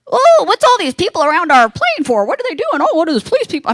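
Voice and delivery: high, swooping mock alarm; nosy fellow passenger. Man by the window spots police, high-pitched wondering. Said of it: "the guy, catty corner from me".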